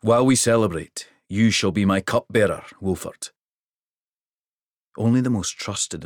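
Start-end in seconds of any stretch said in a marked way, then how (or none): 0.0-3.3 put-on louder, deep rural Hrothy accent; proud father king in rural Hrothy accent; king boasting of his daughter, rural Hrothy accent. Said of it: "deep rural accent".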